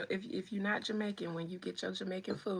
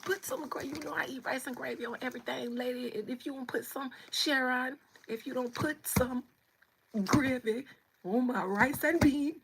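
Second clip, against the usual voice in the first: raised, scolding voice getting louder; mock indignant complaint; 4 semitones higher, 6 dB louder than usual. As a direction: build from rapid grumbling to loud, emphatic demands. Acted quarrel with server over gravy.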